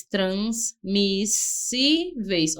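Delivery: transmissíveis stretched very slowly; teacher slowly spelling out transmissíveis; slow demo. Shown as a demonstration. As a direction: draw transmissíveis out to show the S S split.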